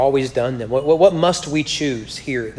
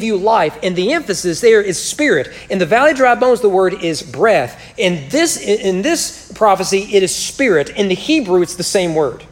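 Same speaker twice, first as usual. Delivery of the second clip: higher, louder preaching, building with fervour; 6 semitones higher, 4 dB louder than usual. Acted. Preacher presses the congregation on the gospel, higher and louder. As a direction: drive the gospel home to the congregation.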